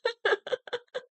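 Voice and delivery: high, helpless laugh; nervous laughter. Real, not acted. Nervous laugh during meltdown.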